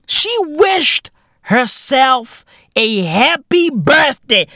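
ordinary delivery

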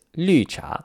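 绿茶 with high falling 绿, then rising 茶; fourth then second tone. Shown as a demonstration. Teacher spelling out the tea order, fourth then second tone on 绿茶. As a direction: drop sharply on 绿, lift on 茶. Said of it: "L U in the fourth tone and C H A in the second tone".

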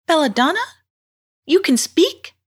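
put-on high, astonished girl's voice; astonished young heroine. A narrator's reading. Girl stunned that the mermaid can speak, astonished. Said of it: "murmured Fujetta".

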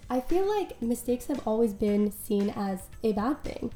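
ordinary talk, nothing marked

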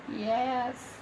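normal talking voice

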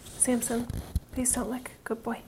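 soft unvoiced whisper to the dog; hushed aside. Real, not acted. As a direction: whisper so the meditation isn't broken. Quiet aside to her dog.

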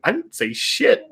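high, slow denial; indignant protest. Retold or imitated. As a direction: deny it in a high, stretched-out voice. Retold protest at being blamed.

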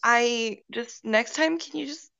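ordinary talk, nothing marked

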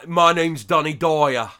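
loud, broad Cockney weatherman; Danny Dyer. Acted. Imagining an actor reading the late-night weather, loud Cockney impression. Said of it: "Danny Dyer telling you the weather".

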